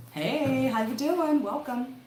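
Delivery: high, loud, drawn-out hello; excited greeting. Real, not acted. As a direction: call out a big welcome to a newcomer. Greets someone joining the stream.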